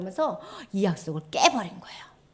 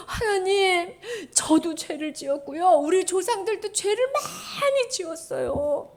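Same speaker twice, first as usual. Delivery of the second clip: repentant prayer; 9 semitones higher, slower than usual. Acted. Voicing Nehemiah's repentant prayer.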